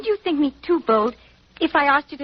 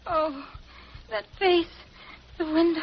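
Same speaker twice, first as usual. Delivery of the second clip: higher, faint, broken fearful murmurs; half-awake and haunted by what she saw; delirious fear, coming round after a blow; semi-conscious, frightened; 4 semitones higher, 5 dB quieter than usual. Acted. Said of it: "she's semi-conscious".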